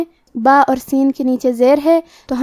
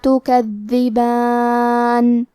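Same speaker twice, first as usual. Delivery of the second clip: slow, melodic tajweed chant; Quran recitation; 2 semitones lower, much slower than usual. Shown as a demonstration. Teacher reciting the verse to students, slow melodic chant. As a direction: draw each vowel out in measured recitation.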